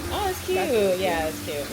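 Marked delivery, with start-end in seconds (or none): 0.1-0.6 delighted coo. Real, not acted. Spotting something cute.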